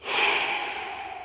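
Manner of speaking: long, soft exhale; weary sigh of frustration; exasperated sigh. Real, not acted. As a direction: breathe out heavily, spent. Vents after the rant.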